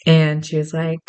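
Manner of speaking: ordinary delivery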